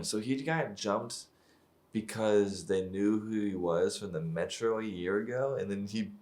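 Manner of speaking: usual speaking voice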